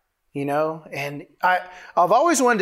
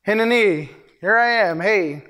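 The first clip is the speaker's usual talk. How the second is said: lifted, announcing call; calling for attention; 3 semitones higher than usual. Acted. Preacher showing how one announces oneself, calling for attention. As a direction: announce yourself with raised pitch. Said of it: "I'm calling the attention of the room".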